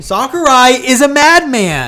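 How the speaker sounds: high, loud, slow proclamation; real gushing, drawn out and high; excited exclamation. Real, not acted. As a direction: proclaim it loudly in fannish awe. Fans gushing, echoing co-host loudly.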